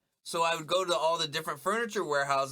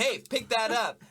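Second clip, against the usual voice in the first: higher, louder mock command; retold order; 7 semitones higher, 5 dB louder than usual. Telling someone who dropped something, raised-voice mock order. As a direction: call it out like an imitated order.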